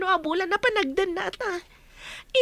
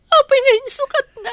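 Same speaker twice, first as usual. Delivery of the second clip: loud accusing cry; angry shout; 4 semitones higher, 9 dB louder than usual. Acted. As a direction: hurl the question at him. Mistress confronting cheating lover. (napungtot nga ikkis)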